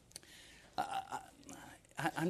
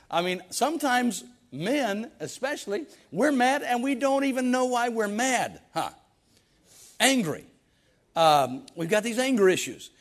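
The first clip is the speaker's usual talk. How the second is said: preaching at full force; 8 semitones higher, 6 dB louder than usual. Acted. Pastor rousing the men about anger, loud preaching. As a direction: drive it home to the men.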